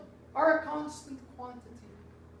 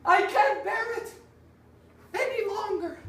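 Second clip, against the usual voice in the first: anguished cry; 6 semitones higher than usual. Acted. Pozzo's outburst continues.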